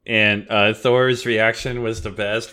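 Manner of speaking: ordinary delivery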